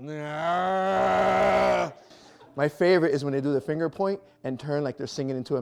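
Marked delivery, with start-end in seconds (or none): 0.1-1.9 mock recoil. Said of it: "and I'm just".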